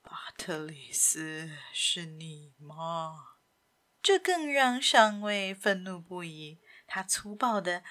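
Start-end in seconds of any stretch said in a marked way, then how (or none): 0.0-3.3 very slow, low whispered murmur. A narrator's reading. Simon dazedly calling to Patrice, murmured. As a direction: murmur it faintly, drawn out. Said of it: "西蒙还是呢喃地说着".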